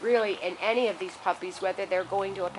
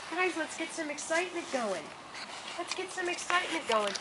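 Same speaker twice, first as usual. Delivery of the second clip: high rallying call; 9 semitones higher than usual. Real, not acted.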